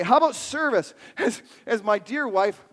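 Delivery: ordinary talk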